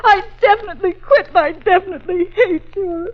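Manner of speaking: fighting back sobs while quitting; hurt and crying at her boss; tearful. Acted. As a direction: voice breaking into tears. Secretary upset after being humiliated.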